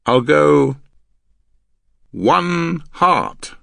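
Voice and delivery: long pause after go, then loud, high one heart; deliberate coded bid. Acted. Man signalling his hand in code, deliberate.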